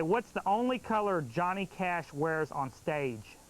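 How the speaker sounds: usual speaking voice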